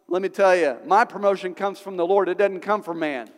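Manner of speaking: usual speaking voice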